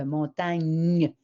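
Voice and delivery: "montagne" with the final "gn" drawn out; held GN sound. Shown as a demonstration. Teacher reminding a child of G N E, "montagne" with lengthened "gn". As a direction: linger on the "gn" that ends "montagne". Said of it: "c'est G N E".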